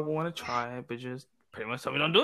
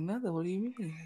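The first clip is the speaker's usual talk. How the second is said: feigned innocence; 5 semitones higher, 5 dB quieter than usual. Retold or imitated. Retold denial to suspicious questioner.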